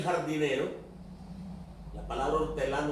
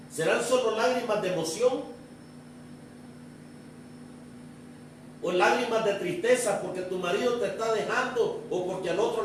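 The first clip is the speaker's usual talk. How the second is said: impassioned preaching; 7 semitones higher than usual. Acted. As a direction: press each question on the congregation. Preaches to the church. (predicación apasionada, con voz alzada)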